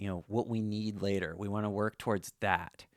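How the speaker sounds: normal talking voice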